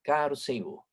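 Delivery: ordinary delivery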